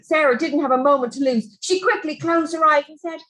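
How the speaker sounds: ordinary delivery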